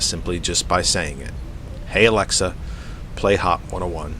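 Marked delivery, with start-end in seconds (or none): none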